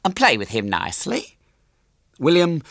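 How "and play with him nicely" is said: higher-pitched motherly instruction; lightly voiced fussy mother; gushing mother. A narrator's reading. Mother tells daughter to play, lightly gushing. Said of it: "said her mother".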